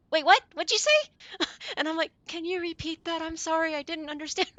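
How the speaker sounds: high, loud imitating of a confused listener; confused surprise. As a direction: act out your baffled "wait, what?".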